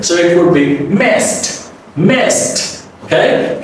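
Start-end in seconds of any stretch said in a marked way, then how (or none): 1.0-1.7 'messed' ending in a soft, crisp T; final T sound. Shown as a demonstration. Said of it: "final D would be the soft T". 2.0-2.9 'messed' said as mest with a soft T; one-syllable 'messed', T at the end; final T sound. Shown as a demonstration. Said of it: "final D would be the soft T".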